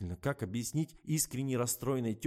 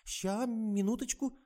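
slow, higher drawn-out drawl; 12 semitones higher, slower than usual. Acted. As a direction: stretch it lazily. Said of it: "протянула Алиса".

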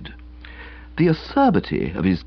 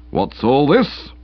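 slow, weighty official challenge; slow stern policeman; 3 semitones higher, slower than usual. Acted. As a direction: repeat it slowly, officially. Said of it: "he repeated".

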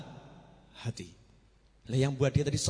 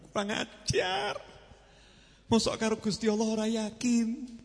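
mock scolding; 6 semitones higher than usual. Scolding the faithless.

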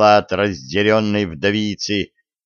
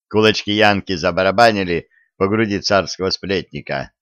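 ordinary delivery

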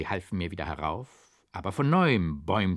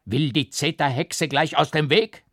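loud, higher angry shout; judge shouting; 6 semitones higher, 7 dB louder than usual. A narrator's reading. Judge yells at the beggar woman, angry shout. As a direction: bark it at the old hag. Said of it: "schrie der Richter".

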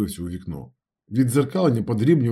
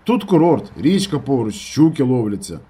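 raised voice, starting high; shouted reply; 4 semitones higher, 5 dB louder than usual. A narrator's reading. Narrator telling his friend about the resort, calling out. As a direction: call the news down the line. Shouted phone call, reporting back.

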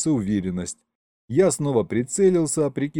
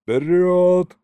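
loud, high rallying cry; raised commander's shout; battle yell; 6 semitones higher, 6 dB louder than usual. A narrator's reading. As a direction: shout the charge to the troops. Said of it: "заорал Колымдай".

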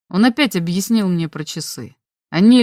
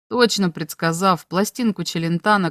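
usual speaking voice

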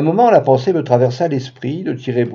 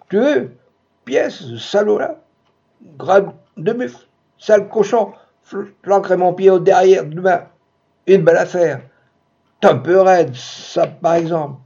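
muffled angry fragments; 6 semitones higher than usual. Acted. Muffled quarrel through the wall.

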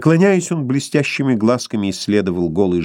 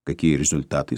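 stern question; 8 semitones lower, 6 dB quieter than usual. A narrator's reading. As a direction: quiz him like a strict doctor. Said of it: "строго спросил".

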